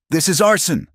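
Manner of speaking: a husband shouting at the man who burned his barn; shouted. Acted. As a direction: yell the accusation high and raw. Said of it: "Elias shouted".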